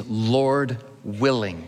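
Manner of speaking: "Lord willing" drawn out slowly; slow reverent phrase. Shown as a demonstration. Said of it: "what we learn to pray".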